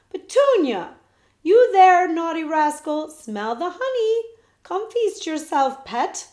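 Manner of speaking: high, loud coaxing call; girl shouting sweetly for her bear. Acted. Betsy calls her bear.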